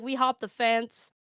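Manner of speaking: ordinary delivery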